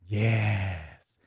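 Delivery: normal talking voice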